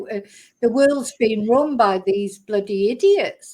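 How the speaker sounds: ordinary talk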